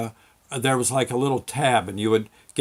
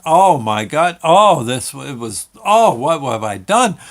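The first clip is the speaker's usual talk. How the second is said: retold yelling; 8 dB louder than usual. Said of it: "Running around screaming and yelling".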